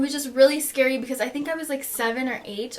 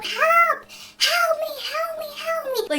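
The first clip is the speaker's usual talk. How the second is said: high, desperate cries for help; screaming for help. Retold or imitated. Injured girl alone screaming for help, high panicked cries. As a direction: call out in panic, pitched way up. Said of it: "So I'm screaming for help".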